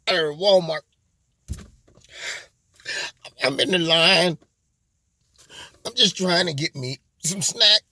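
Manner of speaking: fake-crying, sniffling livestream confession; mock tearful victim. Shopper tells followers about a Walmart run-in, mock tearful. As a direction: play it choked up, milking the sympathy.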